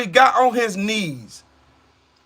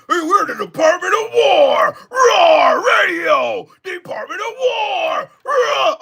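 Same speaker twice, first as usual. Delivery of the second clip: mock-macho roaring; 7 semitones higher, slower than usual. Streamer mocking the Department of War name, hammed-up roaring. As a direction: roar it like a pretend tough guy.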